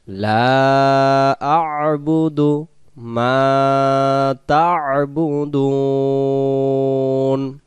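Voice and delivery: slow melodic tajweed chant; Quran recitation. Shown as a demonstration. Teacher reciting the verse for students, slow chant. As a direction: recite slowly, stretching each vowel. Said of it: "বলেন আমার সাথে সাথে".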